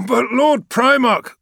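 high, loud, slow objection; protesting shout. Acted. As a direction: cry out in protest. Gargo protests.

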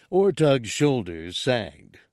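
ordinary delivery

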